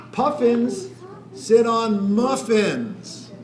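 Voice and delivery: put-on slow, sing-song rhyme reading to children; slow rhyming read-aloud. A narrator's reading. Reading a rhyming picture book to children, slow and sing-song. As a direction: lay each rhyme out slowly for the kids.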